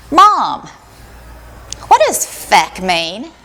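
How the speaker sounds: put-on child voice. Son Corbin voiced asking.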